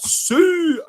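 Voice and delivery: high drawn-out celebration cry, mock Scottish; Scottish accent. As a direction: call out Sue high with a Scottish vowel. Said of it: "I say Sue in a Scottish accent".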